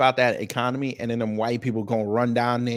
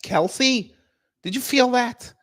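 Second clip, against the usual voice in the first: high, startled question to a partner; alarmed call; 11 semitones higher, slower than usual. Acted. Acted quake scene, calling to Kelsey.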